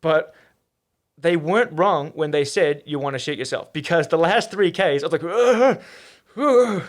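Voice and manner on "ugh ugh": loud, higher-pitched strained groan; pained groan. Retold or imitated. Runner recalling the last three Ks, pained groan. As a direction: imitate a groan of gut trouble. Imitates gut distress late in run.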